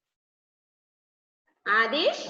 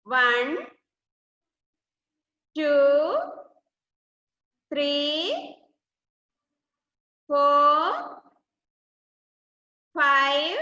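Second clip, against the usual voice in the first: loud, drawn-out numbers with long gaps; loud counting; 3 dB louder, much slower than usual. Shown as a demonstration. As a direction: count out loudly for the class to repeat. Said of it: "Say loudly".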